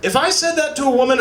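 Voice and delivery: usual speaking voice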